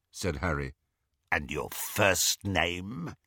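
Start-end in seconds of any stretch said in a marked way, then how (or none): 1.3-3.2 lightly voiced rasping menace; a hint of a rasping beast; rasping werewolf. A narrator's reading. Greyback interrogates.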